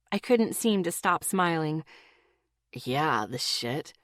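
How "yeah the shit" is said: low, soft grumble; grumbling. A narrator's reading. Boyfriend grumbling about his friend, low and soft. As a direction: mutter it under your breath, much lower. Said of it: "Dylan grumbled".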